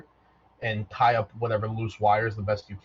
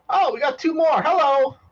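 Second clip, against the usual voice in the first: very high, loud robot greeting; an eager robot spotting newcomers; 7 dB louder than usual. Acted. Robot greeting two more players, high and loud. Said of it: "and he goes".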